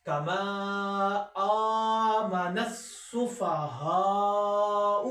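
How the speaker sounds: slow melodic tajweed recitation; Quran recitation. Shown as a demonstration. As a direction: recite slowly and carefully.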